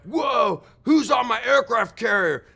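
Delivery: high, loud startled shout; startled admiral. Retold or imitated. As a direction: call out in alarm at the intruder.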